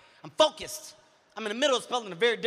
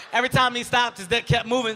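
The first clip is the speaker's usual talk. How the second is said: normal talking voice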